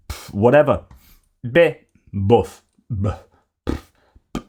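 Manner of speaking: mock Gallic pff and buff noises; French dismissive puffs. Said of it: "French facial expressions".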